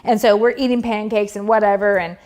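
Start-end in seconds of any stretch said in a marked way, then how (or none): none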